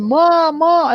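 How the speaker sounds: acted-out yell for mom; retold yelling. Stranded on the temple calling to mom, yelling. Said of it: "I start yelling".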